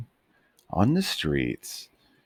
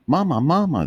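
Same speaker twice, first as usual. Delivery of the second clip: high, louder pup voices calling; puppies calling; 13 semitones higher, 7 dB louder, faster than usual. Acted. Puppies calling their mother, high excited calls. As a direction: call out high and eager.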